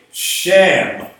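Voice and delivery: "Sham" with a short a, closed on the m; closed syllable. Shown as a demonstration. Teacher isolating the first syllable, "Sham" closed firmly on the m. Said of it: "you really should pronounce that".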